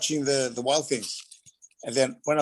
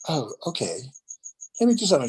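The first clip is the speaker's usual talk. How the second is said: put-on old lady Phyllis; 4 semitones higher than usual. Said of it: "an old lady called Phyllis".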